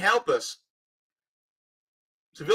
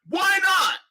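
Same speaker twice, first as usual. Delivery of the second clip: indignant repeat; 13 semitones higher than usual. Real, not acted.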